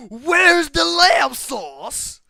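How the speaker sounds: put-on very loud, high British-accented yell, words stretched out; shouted British accent. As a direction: bellow it slowly in a mock British accent.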